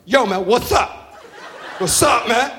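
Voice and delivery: slow, drawn-out "yo, man" like a wannabe gangster; teen wannabe gangsta voice. Retold or imitated. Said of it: "This is how he talks to me".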